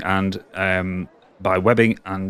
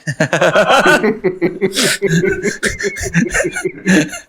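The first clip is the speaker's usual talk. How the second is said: gleeful creature giggle; high giggling cackle; 10 semitones higher, 4 dB louder than usual. Acted. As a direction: cackle like a delighted spider. Creature cackles after refusal.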